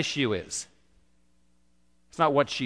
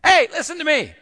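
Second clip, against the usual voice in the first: high, loud demanding yell; acted-out shriek of an insistent woman; screaming; 13 semitones higher, 8 dB louder than usual. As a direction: scream for attention at someone ignoring you. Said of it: "She screamed at him".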